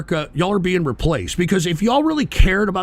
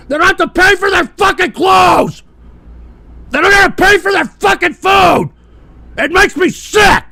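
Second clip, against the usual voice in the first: much higher, much louder enraged yelling; furious shout; 13 semitones higher, 7 dB louder than usual. Real, not acted. Streamer explodes at youths who pay for nothing, screaming. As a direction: scream it out in disgust. Ranting about freeloading youth.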